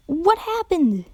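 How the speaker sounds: firm demanding repeat. A narrator's reading. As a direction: press hard for an answer. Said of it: "the sound demanded".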